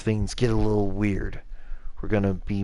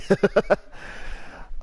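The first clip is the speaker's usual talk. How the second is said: high laugh; 12 semitones higher than usual. Real, not acted. Embarrassed laugh after own mistake.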